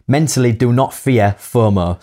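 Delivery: fomo with a prominent Hull accent; strong Hull vowels in FOMO. Real, not acted. Co-host reading tip number six, prominent Hull accent. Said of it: "my Hull accent came right in there".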